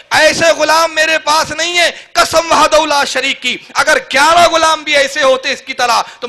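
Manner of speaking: loud, rising fiery sermon; full-force preaching. Acted. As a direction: preach it out hard to the crowd. Preaching climax to crowd. (جوشیلی بلند آواز میں خطابت)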